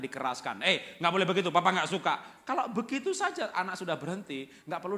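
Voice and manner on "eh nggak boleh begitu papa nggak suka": put-on loud, scolding a misbehaving child; stern parent scolding. Father telling off his child, loud and stern. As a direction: snap at the kid like a strict father.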